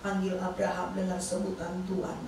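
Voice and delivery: low, measured divine instruction, lightly voiced; voice of God. God speaking to the wife in the bathroom, lightly voiced low command. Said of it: "Tuhan bicara begini".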